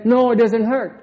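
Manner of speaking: loud, high-pitched protest of denial; mock denial. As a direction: act out an indignant, over-insistent no. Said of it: "and then he says".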